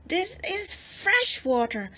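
high, slow, disgusted monster voice; disgusted cry. Acted. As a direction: spit it out in disgust. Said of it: "cried the monster in disgust".

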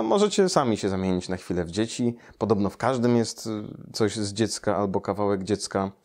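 usual speaking voice

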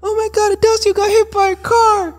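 put-on very high, squeaky kid voice yelling the news; panicking little kids. Little kids running up to tell dad, shrill and frantic. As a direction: play a frantic small child shouting. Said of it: "a bunch of little kids running to him like".

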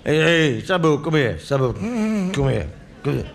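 put-on drunk bloke bellowing his order. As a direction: shout for the hottest curry like a drunk. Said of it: "drunk orders curry in cafe".

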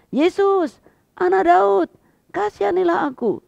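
high, loud cry for mercy; preacher acting out the beggar's loud, high plea; shouting. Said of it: "Dia teriak".

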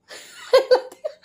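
high breathy burst of laughter. Real, not acted. (ऊँची हँसी)

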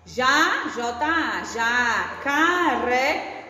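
jacaré stretched out very slowly; slow sounding out. Shown as a demonstration. Teacher to young pupils, sounding out slowly. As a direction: drag out jacaré syllable by syllable. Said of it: "teacher sounds out word for kids".

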